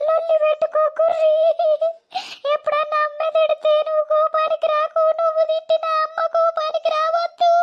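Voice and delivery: high wailing lament; tearful, high-pitched parting advice; crying. Acted. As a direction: plead through sobs, keening high. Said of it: "ఏడవకు, ఏడవకు బాంచనవ్వా".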